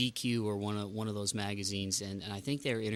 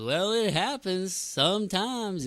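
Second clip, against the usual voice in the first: high, slow Southern simple drawl; slow, earnest Southern man; Forrest Gump voice; 10 semitones higher, 5 dB louder, slower than usual. Retold or imitated. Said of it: "He goes".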